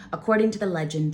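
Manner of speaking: ordinary delivery, nothing marked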